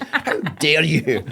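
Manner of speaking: slow, laughing mock indignation; hammed-up laughing outrage. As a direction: stretch it out, pretending to be offended. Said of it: "I was outraged".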